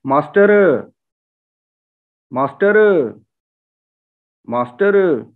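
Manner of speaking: मास्टर with a schwa in the last syllable; village master. Retold or imitated. Teacher imitates the village word master. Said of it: "ये हकीकत में श्वा है".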